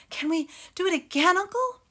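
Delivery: high, loud, fast eager plea; eager cub. A narrator's reading. Cub asking uncle to run again, breathless and eager. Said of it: "Between pants".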